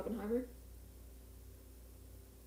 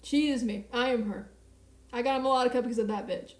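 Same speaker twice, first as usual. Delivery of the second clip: ordinary talk, nothing marked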